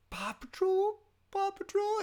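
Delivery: imitating a toddler suddenly thrilled awake; squeaky high delight at the name; excited toddler. Sleepy son perks up at show.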